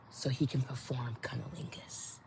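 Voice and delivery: hushed, breathy confession; whisper. Real, not acted. Telling viewers a private detail in a whisper. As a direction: whisper it like a secret.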